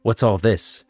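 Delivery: ordinary delivery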